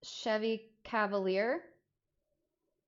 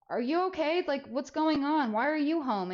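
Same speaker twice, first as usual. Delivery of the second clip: higher, worried questions; concerned kid; 7 semitones higher, 3 dB louder than usual. Retold or imitated. Teen to upset mom, higher concerned questions. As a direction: check on her gently. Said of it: "and I'm like".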